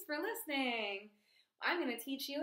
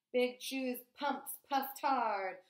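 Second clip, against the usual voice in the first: ordinary delivery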